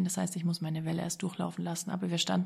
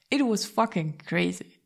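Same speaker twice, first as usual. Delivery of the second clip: slow, emphatic and raised; exultant; 3 dB louder, slower than usual. Real, not acted. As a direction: savour every word. Exulting after the news.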